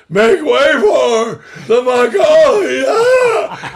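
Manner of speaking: slow shout that breaks into a high cry; voice cracking shout. Retold or imitated. An exhausted actor's final cue, voice breaking. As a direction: strain the shout until the voice cracks. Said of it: "he had been working all day".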